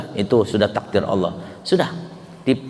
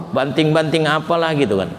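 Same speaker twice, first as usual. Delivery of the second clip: ordinary talk, nothing marked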